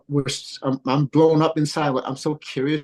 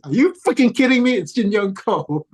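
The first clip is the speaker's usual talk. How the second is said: much higher and louder, drawing out the name; mock disbelief at the name; imitating daughter's high-pitched disbelief; incredulous shriek; 9 semitones higher, 4 dB louder than usual. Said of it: "she's like".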